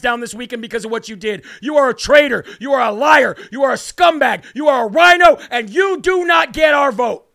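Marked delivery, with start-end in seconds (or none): 1.6-7.3 shouting each insult louder, building to a roar; furious shout. Real, not acted. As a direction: bellow out the accusations at the candidate. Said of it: "I just got heated".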